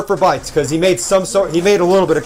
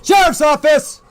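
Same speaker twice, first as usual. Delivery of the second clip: shouted announcement; 10 semitones higher, slower than usual. Real, not acted. K9 search, announcing police presence.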